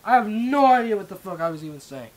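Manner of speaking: real exasperated outcry; loud exasperation. Real, not acted. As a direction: throw up the complaint loudly. Frustrated at own garbled speech.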